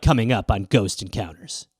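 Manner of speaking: a mock teaser before the break; TV announcer tease. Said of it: "And then they're like".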